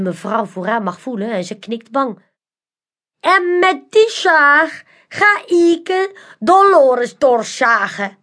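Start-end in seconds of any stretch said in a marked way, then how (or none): 3.2-8.2 high, slow, theatrical magician voice; mysterious magician. A narrator's reading. Magician Manolo invites the crowd, slow and theatrical. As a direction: lure the crowd in like a showman.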